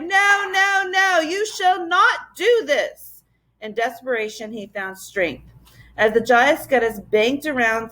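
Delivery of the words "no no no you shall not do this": much higher, slow defiant cry; desperate cry. A narrator's reading. The professor defying the giant bird, desperately. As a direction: defy the monster in despair. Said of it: "bellowed in dismay".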